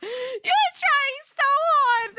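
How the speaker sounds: high, slow, laughing teasing; mocking laugh. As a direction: cackle the tease up high.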